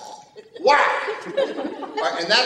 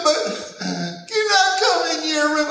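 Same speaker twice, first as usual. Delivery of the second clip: put-on much higher, louder sing-song child's plea; little girl pleading; 10 semitones higher, 4 dB louder than usual. Daughter asking dad to sleep in his room, high childlike plea. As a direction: ask like a small girl begging to come in. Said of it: "She said".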